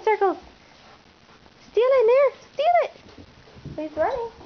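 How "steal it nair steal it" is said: high, loud urging; excited cheer. Real, not acted. Owner cheering dog to steal toy, high and loud.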